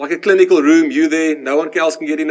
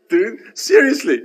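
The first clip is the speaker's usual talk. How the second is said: slow, higher-pitched mock disbelief; incredulous; 4 semitones higher, slower than usual. Patient asked to pick oxygen or medication, mock incredulous. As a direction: drag it out in comic incredulity.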